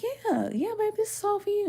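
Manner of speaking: put-on housing lady reassuring the girl, high and sweet; kind housing lady. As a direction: coo it to her like a caring helper. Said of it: "the lady was like".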